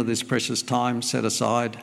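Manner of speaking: ordinary talk, nothing marked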